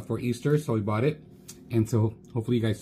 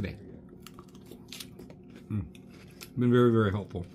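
chewing between words; talking through a mouthful; mouth full, chewing; 4 dB quieter than usual. Real, not acted. Seen 1: Forkful of salad goes in at -2; lips move chewing through words.

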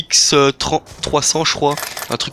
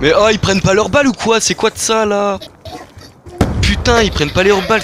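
exasperated rant; 5 semitones higher than usual. Real, not acted. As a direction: rant at useless teammates. Teammates not playing, ranting.

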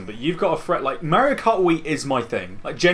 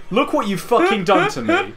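higher pitched wail of blame; mock outrage; 10 semitones higher than usual. Real, not acted. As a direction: whine the blame at him. Blaming friend for losing.

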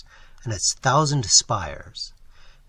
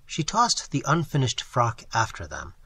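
ordinary delivery, nothing marked

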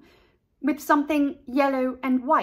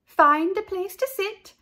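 high, loud teacher voice; teacher calling out; cheerful teacher; 10 semitones higher, 6 dB louder than usual. A narrator's reading. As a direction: address the class. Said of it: "said Miss Pimento".

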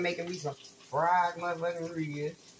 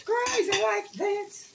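high mock voice; 13 semitones higher, slower than usual.

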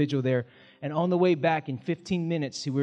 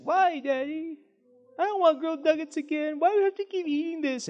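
put-on much higher childlike whine; imitating crying daughter; 13 semitones higher than usual. Pastor imitating his daughter refusing grilled nuggets, high whine. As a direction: whimper like a sulky little girl. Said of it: "she's like".

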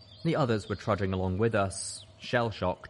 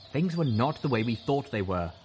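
ordinary delivery, nothing marked

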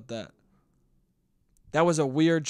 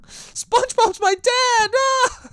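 put-on very high, loud, shrieking kid voice ending in a scream; scared kids; 7 dB louder, slower than usual. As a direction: squeal like terrified little kids. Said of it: "these kids are like".

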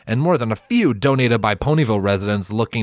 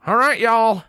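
Applejack calling out; 13 semitones higher than usual. A narrator's reading. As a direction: hail the group from afar. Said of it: "Applejack called out".

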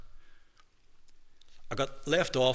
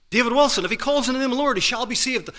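much higher, louder insistent preaching; emphatic preaching; 10 semitones higher, 7 dB louder, faster than usual. Retold or imitated. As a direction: drive the promise home to David. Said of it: "he emphasized the".

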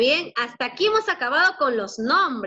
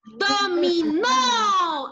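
"Dominó" very slowly, stressed on the final "nó"; stress on last syllable; 3 semitones higher, much slower than usual. Shown as a demonstration. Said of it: "Lleva tilde en la última". ("Dominó" lento, con acento en la última O)

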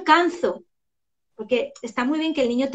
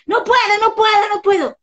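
put-on high, loud childish complaint; whining child; 9 semitones higher, 8 dB louder than usual. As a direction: whine it like a stuck kid. Child refusing, retold. (niño quejándose, agudo y fuerte)

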